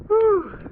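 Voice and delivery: loud high woo; cheering whoop. Real, not acted. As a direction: shout it out in triumph. Cheering after the ice dip.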